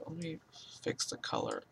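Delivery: "fix the color" barely whispered; muttered under breath. Real, not acted. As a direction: mumble "fix the color" to yourself. Muttered then repeated aloud.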